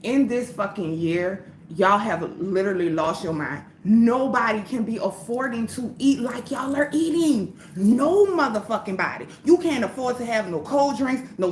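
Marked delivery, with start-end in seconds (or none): none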